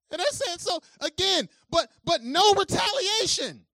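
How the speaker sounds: high, loud preaching. Acted. Pastor driving home the irony to the congregation, full-force preaching.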